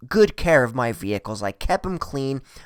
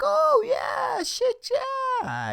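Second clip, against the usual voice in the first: over-eager agreement; 4 dB quieter, much slower than usual. Retold or imitated. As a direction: agree in a squeaky, drawn-out falsetto.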